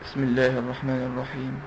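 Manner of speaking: very soft, low-pitched Quran recitation; barely audible, low, soft chant; hushed recitation. Shown as a demonstration. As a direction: recite it under the normal recitation voice. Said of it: "بصوت أقل من صوت القراءة".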